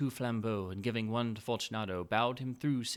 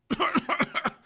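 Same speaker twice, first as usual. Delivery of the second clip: coughing fit; 9 dB louder than usual. Acted. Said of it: "found it impossible to reply".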